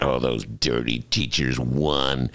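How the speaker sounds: low, slow sneering editorial voice; mock editorial voice. Mocking the editorials in a low, slow sneer. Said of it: "The general theme of both editorials is that".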